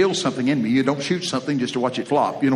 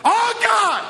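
Mike's intense prayer; 13 semitones higher than usual. Retold or imitated. Friend Mike praying hard over a meal, hammed-up high fervent voice. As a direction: pray over the meal like your life depends on it. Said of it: "here's how he was praying".

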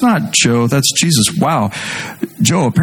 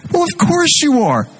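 mock obvious; 11 semitones higher, slower than usual. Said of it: "And I'm like".

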